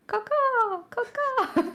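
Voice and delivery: high shrill caw caws; bird cawing. Retold or imitated. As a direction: screech like a crow. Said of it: "She's gonna go like".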